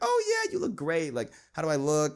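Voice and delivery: very high squeaky mock girl voice; fake-sweet squeal. Mocking a fake friend answering a looks question, high squeak. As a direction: squeal it falsely bright. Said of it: "they'd be like".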